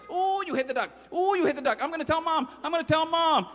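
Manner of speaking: high, sing-song tattletale; teasing little sister. Acted. Little sister taunting her brother, high and sing-song.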